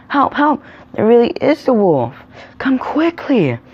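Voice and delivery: high, loud acted shouts for help; false alarm cry. A narrator's reading. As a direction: sound the alarm with pitch way up. Boy raising another false alarm.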